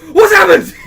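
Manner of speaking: very loud, high-pitched imitated yell; alarmed shout. Retelling a housemate charging downstairs, mock alarmed shout. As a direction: yell it as a hammed-up panicked question.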